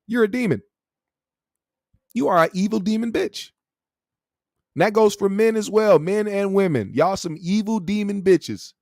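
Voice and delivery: ordinary talk, nothing marked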